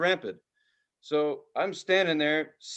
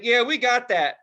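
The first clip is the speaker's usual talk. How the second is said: bystander's reply; 6 semitones higher, 6 dB louder than usual. Retold or imitated. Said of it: "And he's like".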